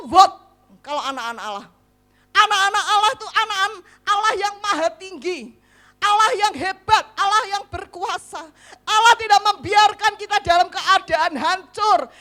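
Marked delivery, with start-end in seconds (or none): 2.3-12.1 loud, high, fast proclamation; rousing preacher's cry; full-force preaching. Acted. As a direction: proclaim it to the whole church. Preaching God's greatness at congregation.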